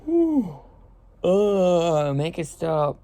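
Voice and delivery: talking through a yawn; words stretched by a yawn. Real, not acted. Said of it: "I hate yawning".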